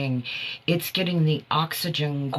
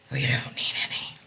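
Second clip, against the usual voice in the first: whisper; 3 semitones lower, 5 dB quieter, faster than usual. Real, not acted. Joking aside about TikTok.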